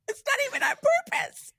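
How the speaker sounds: very high, squeaked mock protest; tiny high-pitched indignant voice; high squeaky protest. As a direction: squeal it in comic self-defence. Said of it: "I'm like".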